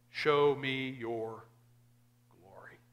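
slow, mildly insistent request; a little demanding. Retold or imitated. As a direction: lean on "Show" as a gentle demand. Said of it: "a little demanding".

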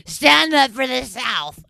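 loud, slow, slurred rough holler; drunk voice imitation. Mocking her old drunk self on video, rough drunken holler. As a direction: drag it out like a raspy drunk yelling. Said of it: "my voice sounds like that".

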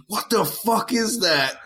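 high, slow imitation of calling out; mock dad holler. Son imitating his dad heard from downstairs, high mock holler. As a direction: pitch it up and stretch it like a faraway yell.

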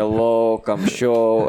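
hammed-up Estonian-Russian accent, pushed out; bad Estonian-Russian accent. Flyering among English speakers, mock Estonian-Russian accent. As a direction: play up a crude Estonian accent. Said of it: "all of a sudden you hear".

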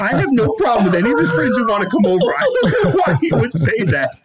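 laughing through a mock flustered denial; imitating mom protesting, half laughing. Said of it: "she won't stop saying".

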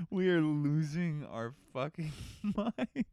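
high, slow, helpless laughing speech; real giggles breaking through the words. Real, not acted. Cracking up at their own silly bit, laughing.

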